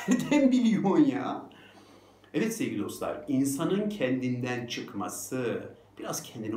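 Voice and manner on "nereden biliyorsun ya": high, hammed-up incredulous question; comic exasperation; exasperated disbelief. Repeats retort, exaggerated with laugh.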